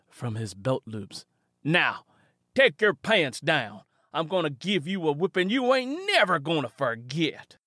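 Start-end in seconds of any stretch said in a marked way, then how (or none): 1.6-7.6 louder, higher, cold order; Jed orders Simi to drop his pants; commanding Jed. Acted.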